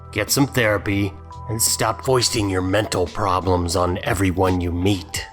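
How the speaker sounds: ordinary talk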